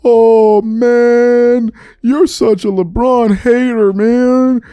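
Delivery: a hint of a whiny fan calling him a hater; mildly mock the fans' accusation; mock accusing fan. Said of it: "And when I said it".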